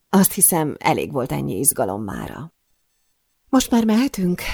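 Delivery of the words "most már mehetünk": high-pitched, slightly scared question; timid. A narrator's reading. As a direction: ask meekly to leave. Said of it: "kérdezem megszeppenve". (kissé megszeppent, magas hangú kérdés)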